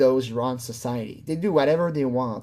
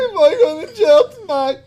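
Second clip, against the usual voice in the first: high, loud fake wail; mock tearful panic; 14 semitones higher, 9 dB louder than usual. Echoing the man scared of jail, mock crying. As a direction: imitate his crying, loud and hammed-up.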